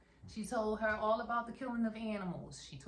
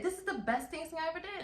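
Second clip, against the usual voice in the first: high proud quote; 10 semitones higher than usual. Retold or imitated. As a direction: squeal it with pride. Voicing Pearl's proud line.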